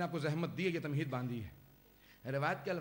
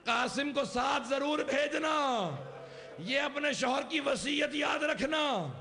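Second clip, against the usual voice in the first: high, loud, drawn-out mourning cry; full-force lament; 8 semitones higher, 6 dB louder than usual. Acted. Preacher crying out a dying husband's last wish, full-force lament. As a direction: build to a slow wailing shout.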